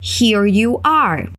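Here you are, very slow and clear; slow model. Shown as a demonstration. Teacher having students repeat, very slow. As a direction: stretch each word for the learner. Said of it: "Let's repeat".

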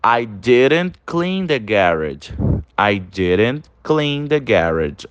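slow, even sentence with "didn't"; slow model reading. Shown as a demonstration. Teacher reading the contracted example to students, slowly. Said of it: "E olha aí a forma contraída do did not".

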